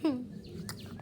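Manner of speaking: quiet unvoiced giggle; stifled laugh; soft breathy chuckle. Real, not acted. Mother laughs.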